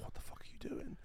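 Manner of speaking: hushed, incredulous whisper; scolding under the breath; whispered rebuke. Acted. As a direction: whisper it to someone making a scene. Girlfriend's hushed rebuke in cinema.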